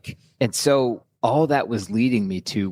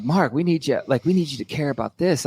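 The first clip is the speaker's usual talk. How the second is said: pleading sister; 6 semitones higher than usual. Retold or imitated. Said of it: "It's like".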